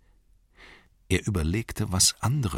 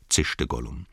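ordinary delivery, nothing marked

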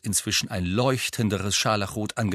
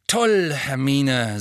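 forced sweetness, louder and slow; boy faking delight for his friend, syrupy, louder and slow; buttery; 5 semitones higher, 6 dB louder, much slower than usual. A narrator's reading. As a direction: lay on fake cheer, syrupy and slow. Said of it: "sagte Harry so buttrig".

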